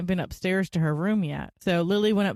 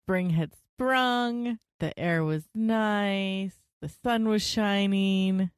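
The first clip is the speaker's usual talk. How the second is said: slow, held-out notes at the line ends; mock-poetic singsong; 3 semitones higher, much slower than usual. Wife setting a springtime scene for husband, mock-poetic singsong. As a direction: wax lyrical, stretching the last word of each phrase.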